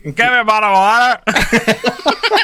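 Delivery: high, loud Belfast "Give me a bottle of water"; laughing through a broad Northern Irish line; Northern Irish accent. Acted. Host answering a co-host's request, laughing Northern Irish accent. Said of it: "can you add a cup of water at the end".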